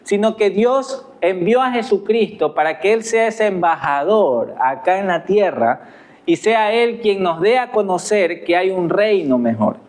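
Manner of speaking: ordinary talk, nothing marked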